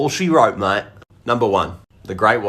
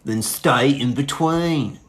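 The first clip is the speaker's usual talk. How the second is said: slow, ominous warning; 2 semitones higher, much slower than usual. Acted. Serious beach safety warning.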